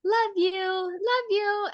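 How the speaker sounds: high, slow little-kid imitation; toddler voice. Toddler learning to tell mom love you, high toddler voice. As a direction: draw it out high like a small child. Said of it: "he's been saying".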